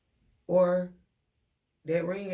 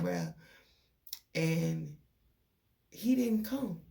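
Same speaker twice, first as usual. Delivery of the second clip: normal talking voice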